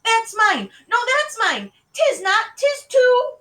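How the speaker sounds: high, loud sibling shouting match; little sisters yelling back and forth; escalating squabble. A narrator's reading. Quarrel reaches its peak.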